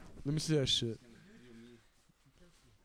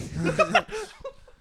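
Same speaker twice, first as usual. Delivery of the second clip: high, loud burst of laughter; 13 dB louder than usual. Real, not acted. Friend amused by a random question, loud laugh.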